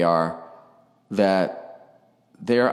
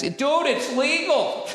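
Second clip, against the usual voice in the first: high, slow dopey dude voice; mock hockey fan; slower than usual. Host quoting hockey fans in a high, slow dude voice. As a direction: stretch it out like a clueless bro. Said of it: "to quote the Tampa Bay Lightning fans".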